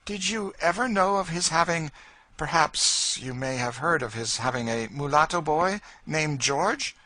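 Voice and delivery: eager high questioning lady; high, loud lady's voice. Acted. Lady asking George about a boy, high eager voice. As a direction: press the question, hopeful.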